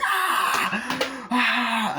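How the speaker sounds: high-pitched mock shriek; hammed-up horror-film wail; imitated scream. Bride screaming when grabbed, imitated scream.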